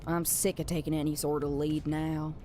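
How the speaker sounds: low, weary complaint in character; grumbling reluctant underling. Acted. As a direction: grumble it low, fed up with leading. Plays a grumbling game character.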